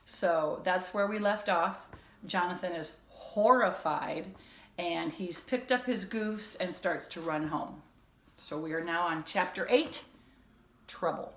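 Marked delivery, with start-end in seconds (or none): none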